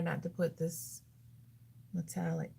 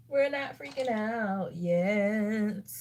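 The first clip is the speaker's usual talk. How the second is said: very slow, stretched-out insistence; emphatic self-reassurance; 3 dB louder, much slower than usual. Real, not acted. Vlogger repeating the reassurance to herself, heavily drawn out. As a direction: drag the words right out, firmly.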